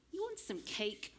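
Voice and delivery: light mock temptation; teasing offer. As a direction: tempt her like a sly sister. Older sister tempting Ashley.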